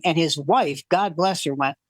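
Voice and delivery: usual speaking voice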